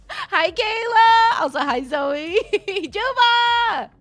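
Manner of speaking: high, loud, drawn-out hellos; squealed hellos with a laugh; excited greeting. Real, not acted. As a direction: call out happily to arriving friends through laughter.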